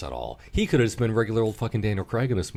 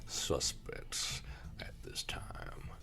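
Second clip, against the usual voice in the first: hushed, breathless southern whisper; mock whisper; 8 dB quieter, slower than usual. Quoting the detective's line to a friend in a hushed whisper. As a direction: whisper it like a sly secret.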